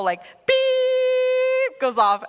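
high, slow, long mimicked alarm beep; alarm beep imitation. Imitating the shop alarm as she walks out, long high beep.

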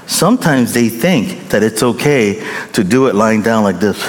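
ordinary delivery

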